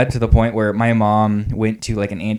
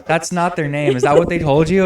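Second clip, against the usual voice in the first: indignant parent; 7 semitones higher, 4 dB louder than usual. Retold or imitated. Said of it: "And they're like".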